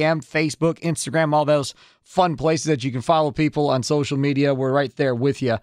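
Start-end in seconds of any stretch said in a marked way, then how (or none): none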